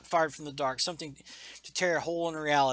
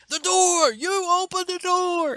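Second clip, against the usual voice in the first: much higher, loud, panicked robot cry; hammed-up robot alarm; robot shouting alarm; 7 dB louder than usual. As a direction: yell the warning like a malfunctioning android. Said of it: "Tornado's like".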